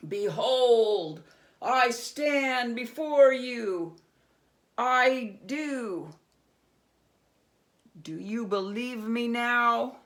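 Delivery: very loud, slow, grand reveal; looming goblin king. Acted. Goblin king booming as he fills the doorway. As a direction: present yourself like a monster.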